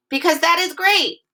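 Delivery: "great" lifted high and excited. Shown as a demonstration. Teacher showing kids why the sentence gets an exclamation point, excited. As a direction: make "that is great" sound thrilling. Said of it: "It's exciting".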